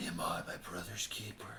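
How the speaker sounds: low, raspy whispered growl; whispered gravel Batman impression. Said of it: "isn't that, like, the Batman voice?".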